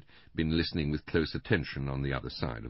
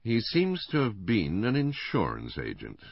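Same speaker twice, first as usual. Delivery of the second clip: measured, careful answer; 7 semitones higher, 4 dB louder than usual. A narrator's reading. Inspector answering a witness about the victim, careful. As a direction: pick the words with care. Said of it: "said the inspector carefully".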